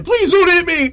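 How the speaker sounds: high, loud begging; mock pleading. Boy fleeing bullies, loud mock plea. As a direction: beg as if about to be hit.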